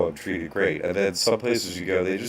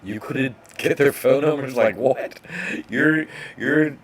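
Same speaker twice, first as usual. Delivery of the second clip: higher, louder mock disbelief; incredulous retort; 5 semitones higher, 4 dB louder than usual. Mocking the authorities' excuse, incredulous and higher. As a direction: scoff at the absurd excuse. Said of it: "I'm like".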